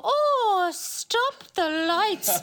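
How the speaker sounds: high "Oh" then a drawn-out, slow "stop the lights"; mother's dismissive exclamation. Retold or imitated. Panellist imitating the mother dodging a question, slow and drawn out. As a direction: stretch "stop the lights" out, brushing off an awkward question. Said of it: "And she'll go".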